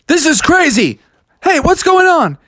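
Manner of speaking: high, excited outcry; amazed onlookers. Acted. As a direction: call out in astonishment. Amazed traders watch the fight.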